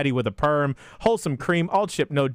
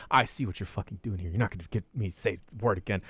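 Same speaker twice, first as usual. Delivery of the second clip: mock muttered grumble; 9 semitones lower, 8 dB quieter, faster than usual. Refusing to say the word.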